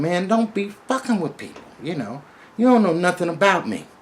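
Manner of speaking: high, loud furious scolding; angry yell. Retold or imitated. Man scolding the robber while beating him, loud and high.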